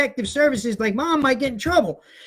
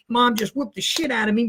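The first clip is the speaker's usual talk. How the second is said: ordinary talk, nothing marked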